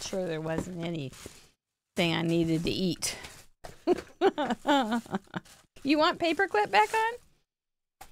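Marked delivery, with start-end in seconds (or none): 5.8-8.1 teasing. Real, not acted. Teasing question to viewers.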